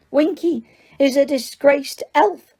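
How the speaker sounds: high, squeaky indignant elf. Acted. Winky answers Hermione with an offended squeak. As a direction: squeak it out, hurt and proud. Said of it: "she squeaked".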